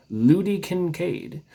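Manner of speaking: ordinary delivery, nothing marked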